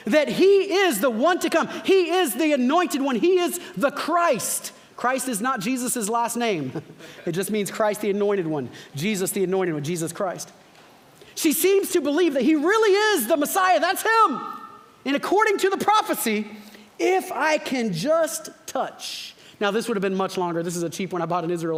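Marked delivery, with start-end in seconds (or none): 0.1-4.7 building preaching shout. Acted. Preacher proclaiming to the congregation, building to a shout. 11.4-19.4 excited preaching. Acted. Preacher climaxing on her belief.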